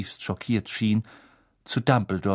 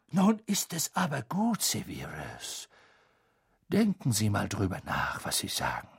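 calm rebuke; 7 dB quieter than usual. A narrator's reading. Dumbledore calming Snape.

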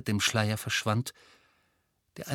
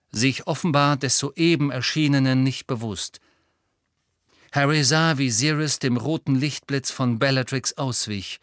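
ordinary delivery

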